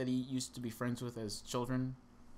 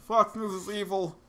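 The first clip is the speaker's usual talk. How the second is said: much higher and louder mock rant; mock ranting viewer; 11 semitones higher, 11 dB louder than usual. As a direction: rant like an outraged commenter.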